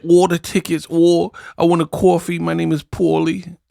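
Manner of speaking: lightly done mock New York accent; fake New York accent. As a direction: parody her put-on New York accent. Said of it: "where she get a fake New York accent".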